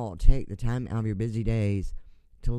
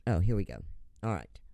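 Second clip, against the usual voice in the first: ordinary talk, nothing marked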